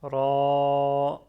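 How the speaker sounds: Ra with the vowel held long and loud; prolonged Ra. Shown as a demonstration. Teacher showing fatha's prolongation, Ra drawn out long. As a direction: stretch the a of Ra on one level note. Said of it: "c'est un prolongement de fatha".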